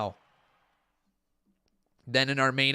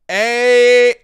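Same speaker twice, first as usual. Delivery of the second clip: loud, higher cheer; hyped shout; 9 semitones higher, 14 dB louder, much slower than usual. Real, not acted. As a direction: yell it like a fan at ringside. Hyped as match starts.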